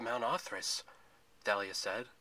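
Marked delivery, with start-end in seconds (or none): none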